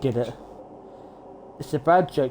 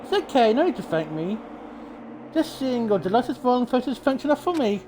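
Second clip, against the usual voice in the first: lightly raised, smug cartoon bear; gloating villain, a touch higher; mascot villain voice; 6 semitones higher than usual.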